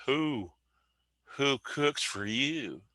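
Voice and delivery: slow, hooting owl call, pitched high; mimicking the owl's hoot; barred owl call. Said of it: "Like that".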